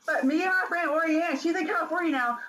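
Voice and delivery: high, eager protest; excited protest. Retold or imitated. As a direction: insist eagerly you made it. Retold protest, pitched up.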